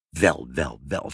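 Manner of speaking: low, soft marvelling; musing wonder. A narrator's reading. Mother marvels at father's new machine, musing wonder.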